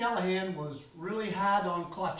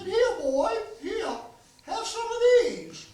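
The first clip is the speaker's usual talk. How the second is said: put-on high, louder old-timer offering a treat; imitating old man Callahan; 13 semitones higher, 6 dB louder than usual. Old man handing a boy a package, high loud old-timer voice. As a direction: pitch way up and call the boy over. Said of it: "he come out and he said".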